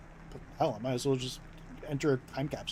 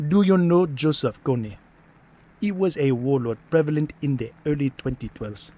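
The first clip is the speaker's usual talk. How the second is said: mock African accent; 10 dB louder than usual. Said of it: "some of us are actually from Nigeria".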